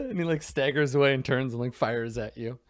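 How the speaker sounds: normal talking voice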